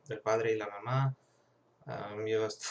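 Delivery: normal talking voice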